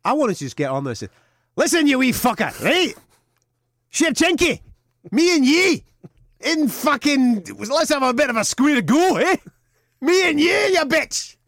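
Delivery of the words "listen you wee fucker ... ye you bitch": screaming high Scottish hard man; full Begbie. Pretend. Host imagines Jojo calling out Shevchenko, shouting wildly. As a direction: roar it like a mad Glasgow brawler. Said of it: "I wanted to go full Ramsey Nesbitt".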